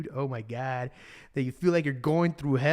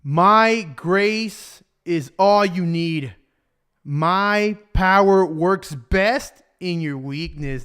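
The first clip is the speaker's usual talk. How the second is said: slow, weighty scripture; drawn-out, forceful delivery of God's promise; emphatic proclamation; 6 dB louder, much slower than usual. Acted. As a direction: proclaim each word deliberately. Proclaims the verse as God's message.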